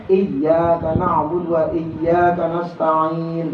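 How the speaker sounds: slow "iyyaka" with doubled ya; careful model reading; slow tajwid recitation; correct tasydid. Shown as a demonstration.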